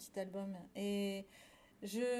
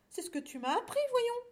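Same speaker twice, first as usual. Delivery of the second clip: higher voice, leaping up on "voyons"; coaxing cow; 12 semitones higher than usual. A narrator's reading. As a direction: coax a sad little friend. Cow urges the lamb. (vache qui encourage affectueusement)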